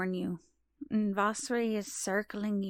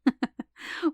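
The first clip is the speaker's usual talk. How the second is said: short knowing laugh, pitched up; 6 semitones higher, 5 dB louder than usual. Acted. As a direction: laugh off the question. Asked if she is really mum.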